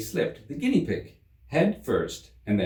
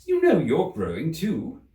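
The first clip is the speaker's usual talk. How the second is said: put-on high, firmer girl's voice; bolder Alice; 8 semitones higher, 4 dB louder than usual. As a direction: stand up to him a little. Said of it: "said Alice more boldly".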